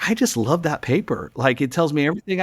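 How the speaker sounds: retold sudden enthusiasm; imitated gushing fan. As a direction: gush like a won-over reader. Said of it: "changed completely".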